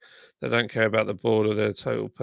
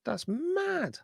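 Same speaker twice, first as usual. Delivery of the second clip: much higher, squeaky disbelieving That's mad; amazed squeal; 7 dB quieter than usual. Real, not acted. Streamer squeals at likes count, amazed.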